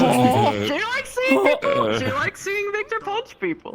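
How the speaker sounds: teasing squeal. Real, not acted.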